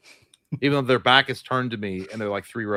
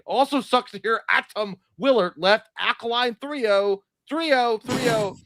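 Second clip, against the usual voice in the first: struggling read; 8 semitones higher, slower than usual. Real, not acted. Reading chat, stumbling.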